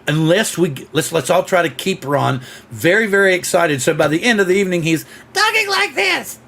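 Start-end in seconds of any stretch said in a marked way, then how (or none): 5.3-6.4 very high, strained mock croak; hammed-up lost-voice impression; imitating a hoarse voice. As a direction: squeak it out like a voice that's gone. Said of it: "Ron's a little bit hoarse tonight".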